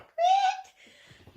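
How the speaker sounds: high squeaked disbelief; loud, very high mock bafflement; baffled reaction. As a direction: squeal it as if totally lost. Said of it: "I'm like".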